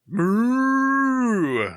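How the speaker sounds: high, silly cow moo. Pretend. Goofy sign-off cow noise.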